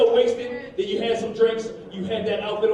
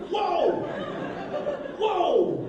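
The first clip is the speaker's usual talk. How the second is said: high, falling exclamation; taken aback, high-pitched; shocked dad; 10 semitones higher than usual. Retold or imitated. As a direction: react startled, pitch jumping up then dropping. Startled father reacting to daughter.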